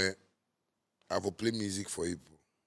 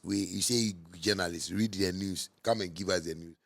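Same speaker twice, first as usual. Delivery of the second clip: usual speaking voice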